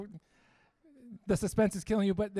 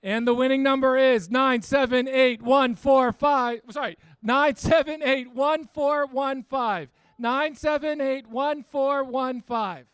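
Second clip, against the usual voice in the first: raffle caller; 3 semitones higher, 7 dB louder, slower than usual. Acted. Announces raffle numbers.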